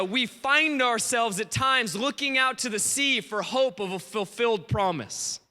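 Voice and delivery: ordinary talk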